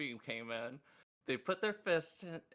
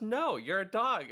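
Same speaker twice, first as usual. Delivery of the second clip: angry Cherry; 6 semitones higher, 7 dB louder than usual. Retold or imitated. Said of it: "And she goes".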